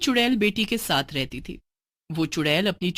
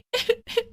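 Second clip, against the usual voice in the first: evil cackle. Acted.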